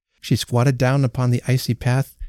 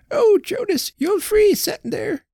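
put-on much higher imploring old wife; pleading old woman. A narrator's reading. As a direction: plead with him to come in. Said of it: "she pleaded".

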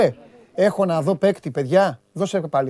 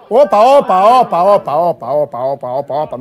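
excited stop calls; 3 semitones lower, 4 dB louder than usual. Real, not acted. Stopping the replay excitedly.

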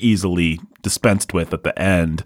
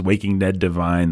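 usual speaking voice

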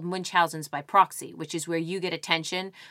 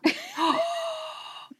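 delighted gasp. Real, not acted. Gasp at twist idea.

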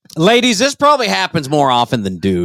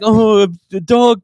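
higher, drawn-out imitation of an upset woman; mock fury; 5 semitones higher, slower than usual. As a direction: mimic her outrage in slow exaggeration. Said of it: "She was just furious, like, pissed".

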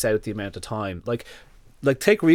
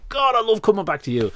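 a lightly higher happy dog voice; voicing the dog; 10 semitones higher, 4 dB louder than usual. Dog loving his owner's praise, lightly higher. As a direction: play the dog delighted. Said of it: "And he's like".

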